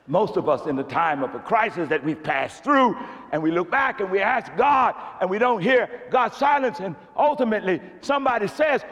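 loud, high, driving sermon climax; preaching at full force. Acted. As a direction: build the congregation up.

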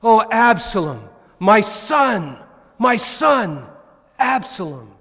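high, loud, slow lament; grieving cry. Retold or imitated. David mourning his dead son, loud anguished cry. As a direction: wail for the lost son. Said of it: "David cries out".

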